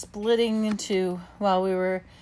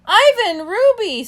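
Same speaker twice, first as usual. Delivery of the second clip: high, loud gleeful greeting; delighted call; 12 semitones higher, 9 dB louder than usual. A narrator's reading. Snickers, delighted, calls to her friends across the water. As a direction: call out to old friends with joy. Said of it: "calls in delighted voice".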